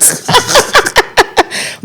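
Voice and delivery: high airy laugh at herself; breathy laugh. Real, not acted. Planner laughing at her own pregnancy story, airy laugh.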